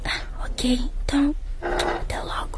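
soft unvoiced farewell; whispered goodbye.

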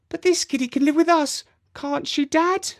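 put-on high, fast child's plea; insisting little girl. A narrator's reading. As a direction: wheedle your dad. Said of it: "insisted Jemima".

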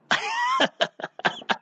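a soft, very high squeak of a laugh; high squeaky laugh. Real, not acted. Host laughing while the little girl is crying on air. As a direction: giggle high and breathless, caught out.

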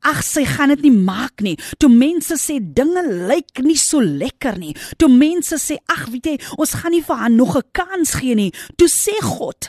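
fervent pulpit cry; preaching at full force. Acted. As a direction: build the sermon to a climax.

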